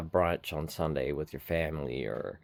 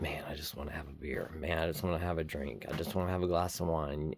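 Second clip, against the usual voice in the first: quiet; 2 semitones lower, 7 dB quieter than usual. Retold or imitated. Retold craving thoughts.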